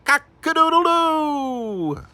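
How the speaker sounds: very high, slow crowing; rooster crow. Acted. Rooster wakes Mister Piper, very high slow crow. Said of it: "a loud noise calling".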